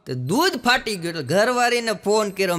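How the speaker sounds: ordinary talk, nothing marked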